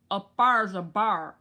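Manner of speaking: put-on "Up there's a bear" run together slowly and loudly in a West Virginia accent. Mimicking West Virginia kin, a slow, loud run-together accent. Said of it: "They say".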